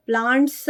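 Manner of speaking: प्लांट्स said as "plaant-suh"; added "uh" after s. Shown as a demonstration. Teacher rejecting a reading, प्लांट्स with a tacked-on "suh". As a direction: give the final s of प्लांट्स its own vowel. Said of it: "नहीं बोला हमने".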